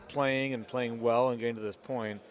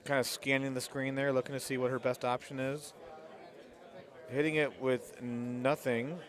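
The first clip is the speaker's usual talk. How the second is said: live play-by-play, easy calls; golf commentator. Acted. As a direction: call the shot as it happens.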